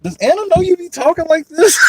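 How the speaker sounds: teasing chat quote. Retold or imitated. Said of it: "Tawana was in the chat like".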